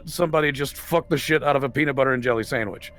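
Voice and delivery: normal talking voice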